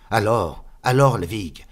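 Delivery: calling out his friend's name too loud; loud greeting. Acted. Friend loudly greets, then shushed. (interpellation bruyante, plus forte)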